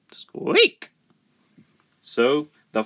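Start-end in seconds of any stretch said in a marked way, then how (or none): 0.1-0.9 very high, whispery squeak; imitated high squeak; rat's squeak. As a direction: squeak like a rat. Rat agrees.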